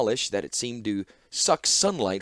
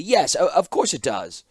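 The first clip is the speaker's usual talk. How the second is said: higher, louder stumbling reassurance; stammering nervous clockmaker; flustered stammer; 6 semitones higher, 6 dB louder than usual. Acted. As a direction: cover the slip, stammering.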